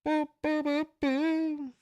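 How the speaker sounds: squeaky high cartoon boops; playful high boops. Streamer booting programs, chirping high playful boops. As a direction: chirp each boop up high like a toy.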